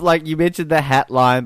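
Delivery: usual speaking voice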